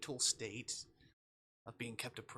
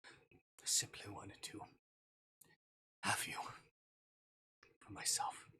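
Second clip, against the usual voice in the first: hushed, slow confession to a captive; slower than usual. Acted. Captor confessing to Snow, hushed. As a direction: let it slip out almost whispered.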